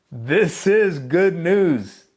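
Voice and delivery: triumphant drawn-out announcement; loud slow proclamation. Acted. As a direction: ring it out like a herald's cry. Preacher proclaims the gospel.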